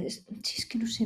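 soft whisper. Real, not acted. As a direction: whisper it lazily. Whispering her lazy wish.

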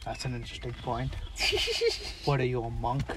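Al Pacino impression. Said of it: "And then Al Pacino says".

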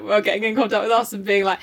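usual speaking voice

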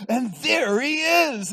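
loud, high, stretched-out holler; hammed-up hail across a restaurant; big booming voice. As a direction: boom it out slowly, drawing out the words. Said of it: "he hears this big voice".

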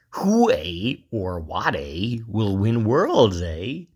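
slow, hammed-up Canadian accent. Player opening a Worlds prediction entry, hammed-up Canadian accent. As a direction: put on your best Canadian accent, drawn out. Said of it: "put on my best, like, Canadian accent".

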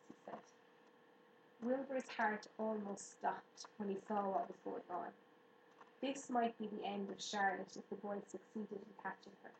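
usual speaking voice

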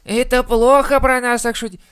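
put-on high, loud complaining kid; whiny child voice. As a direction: whine like an offended kid. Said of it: "ребёнок ... не сказал".

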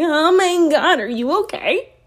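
high-pitched imitation of a startled man; mock alarm. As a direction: act out his startled concern, voice jumping up. Voices the man's alarmed reaction.

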